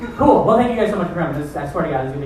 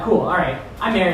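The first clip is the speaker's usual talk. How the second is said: normal talking voice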